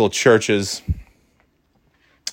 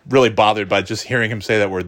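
usual speaking voice

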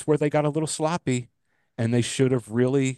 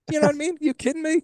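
very high, disbelieving squeak; can't believe it, pitch shoots up; incredulous; faster than usual. Real, not acted. Incredulous about watching hockey far away.

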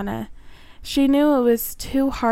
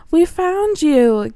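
slow, high and loud with triumph; thrilled at finding Morana; excited; 6 semitones higher, 6 dB louder, slower than usual. Acted. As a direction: announce it in triumph. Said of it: "Gilly said excitedly".

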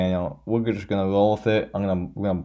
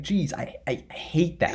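usual speaking voice

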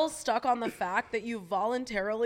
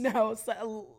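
ordinary talk

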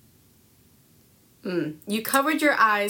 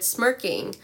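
normal talking voice

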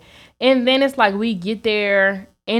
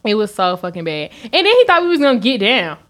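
ordinary talk

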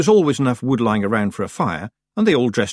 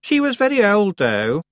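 put-on raised, superior young witch; haughty girl voice; 12 semitones higher, 4 dB louder than usual. A narrator's reading. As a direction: talk down to the others. Anagrama voiced in story.

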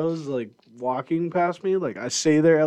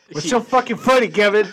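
angry yell; 7 semitones higher, 4 dB louder than usual. Retold or imitated. Retells yelling at Kevin in dream.

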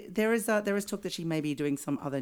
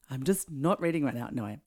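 ordinary delivery

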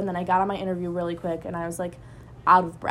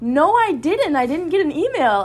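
excited disbelief; 8 semitones higher, 7 dB louder than usual. Retold or imitated. Disbelief at being accepted.

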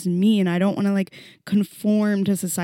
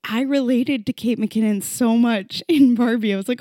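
normal talking voice

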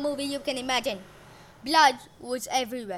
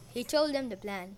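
usual speaking voice

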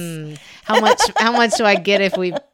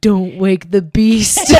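cat's mock warning; 5 dB louder, much slower than usual. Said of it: "He was like".

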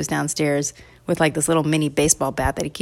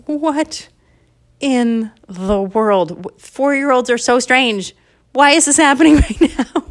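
higher, incredulous dad; baffled dad; 6 semitones higher, 4 dB louder than usual. Acted. Dad finds his son asleep with a straw, amused bafflement. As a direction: draw out the disbelief, rising into laughter. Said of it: "And the dad is like".

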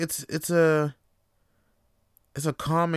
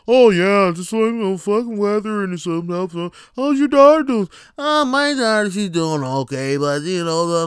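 imitating chatty neighbours; mock small talk chatter; 3 semitones higher, 5 dB louder than usual. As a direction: play both sides of a dull weather chat.

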